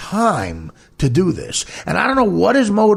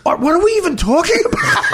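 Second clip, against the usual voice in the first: retold question breaking into a laugh, much higher; half-laughing, as if it's absurd; laughing disbelief. Friend Joe's baffled reply retold.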